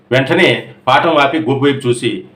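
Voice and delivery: plain reading voice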